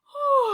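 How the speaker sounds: high loud yawning sigh; big yawn. Acted. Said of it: "Amos yawned".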